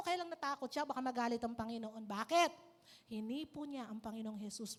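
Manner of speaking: ordinary talk, nothing marked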